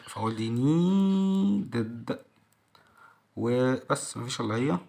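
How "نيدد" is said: "نيدد" (needed) with a long held "neee", then "ded"; separate "id" syllable. Shown as a demonstration. Teacher sorting endings aloud, drawn-out separate "id" syllable. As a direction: stretch the first vowel of "نيدد", then sound "ded". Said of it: "ونيدد هتبقى مع الاد".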